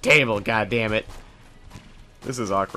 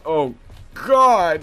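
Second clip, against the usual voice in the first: startled; 7 semitones higher, 8 dB louder than usual. Real, not acted. Lucky dodge in the game.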